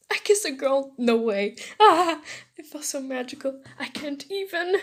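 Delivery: loud, giddy squeal with a laugh; thrilled. Acted. Boy alone after his first kiss, thrilled.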